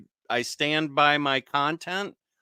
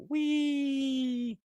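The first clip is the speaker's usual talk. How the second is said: excited squeal; 11 semitones higher, 6 dB quieter than usual. Real, not acted. Celebrating New Year on stream.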